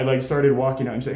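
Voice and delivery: ordinary talk, nothing marked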